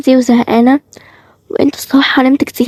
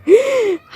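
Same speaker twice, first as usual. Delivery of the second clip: shocked gasp; 11 semitones higher than usual. Acted. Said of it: "بطلي تتصدمي".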